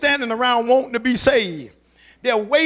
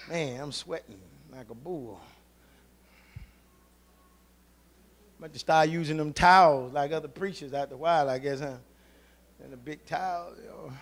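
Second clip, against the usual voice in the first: barely audible, to himself off mic; half-swallowed joke under the breath; muttered aside; 10 semitones lower, 11 dB quieter than usual. Real, not acted. Preacher jokes to himself about sweating, muttered very quietly.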